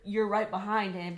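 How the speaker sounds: ordinary delivery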